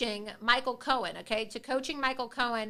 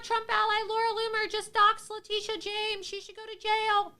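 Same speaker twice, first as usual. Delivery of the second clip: high, nasal mocking whine; whiny critic voice; 11 semitones higher than usual. Mimicking critics who complain about doxing, in a high mocking whine. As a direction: whine it like a tattling crybaby. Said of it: "all these people do is whine".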